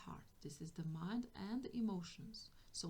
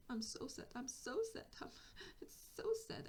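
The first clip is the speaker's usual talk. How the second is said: mock sobbing, climbing higher; acted tearful child; 4 semitones higher, 4 dB quieter than usual. Inner child wallowing in sadness, mock tearful. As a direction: play a sad child, breath catching. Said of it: "and she's crying".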